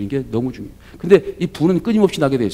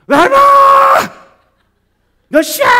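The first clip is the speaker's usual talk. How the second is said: much higher, louder mock yell; angry yelling wife; 10 dB louder than usual. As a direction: imitate a wife snapping at her late husband. Wife furious on phone to husband. (늦은 남편에게 전화로 소리치는 아내, 화난 고함)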